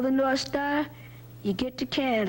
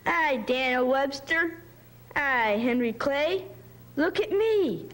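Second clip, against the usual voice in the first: put-on higher, slow boyish calling out; playful holler; 6 semitones higher than usual. A narrator's reading. Tommy calling to the horses from the loft, mild holler. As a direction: call down happily from the loft. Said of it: "He hollered down to the horses".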